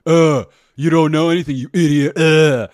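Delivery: loud, scornful put-on voice of a grumpy soldier; mock angry guy. Comic mimics the annoyed military cop's loud scornful brush-off. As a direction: bark it out at the pushy comic. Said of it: "feel that vibe of him going".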